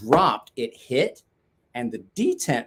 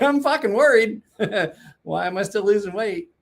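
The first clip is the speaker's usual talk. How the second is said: mock worried; 8 semitones higher, 4 dB louder than usual. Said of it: "where I'm like".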